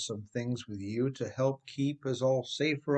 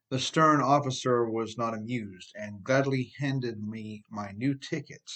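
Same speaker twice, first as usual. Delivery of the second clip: plain reading voice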